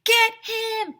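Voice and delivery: high, loud yell; shout. Acted. Ryan yelling at the class to chase the zombie. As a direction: bark the order as they run. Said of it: "Ryan shouted".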